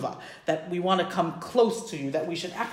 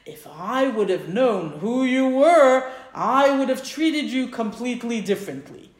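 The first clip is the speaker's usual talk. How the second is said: slow, louder retold insistence; emphatic protest; 3 semitones higher, 4 dB louder than usual. Innkeeper explains his regret to the rabbi, emphatic slow protest. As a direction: stretch it out with heartfelt emphasis.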